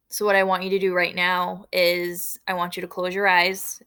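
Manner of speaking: ordinary talk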